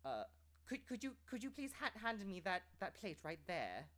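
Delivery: high, prim posh English voice; posh British request. Shown as a demonstration. Voice actor keeps demoing posh British, politely asking. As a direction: ask for the plate like an old-money Brit. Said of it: "you know".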